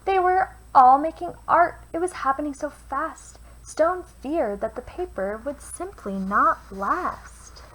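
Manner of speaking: put-on lively read-aloud for children; storyteller voice for kids; picture book reading. A narrator's reading. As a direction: read it like bedtime rhymes. Reads a rhyming picture book.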